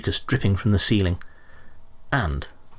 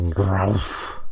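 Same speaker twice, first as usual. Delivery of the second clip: bear growl. A narrator's reading. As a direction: growl back like a beast. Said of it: "something growled".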